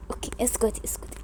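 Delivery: hushed "be quiet"; urgent hushed shushing; whispered shush. Acted.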